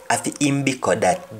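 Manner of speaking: "Afi im biko that" fast in Jamaican patois, rising on "im"; quick patois take. Shown as a demonstration. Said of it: "that is its food".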